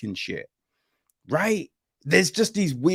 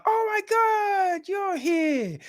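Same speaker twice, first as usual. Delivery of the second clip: much higher, mock-gushing greeting voice; gushing friend; slower than usual. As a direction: squeal it like an overjoyed fake friend. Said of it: "like, the".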